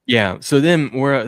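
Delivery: normal talking voice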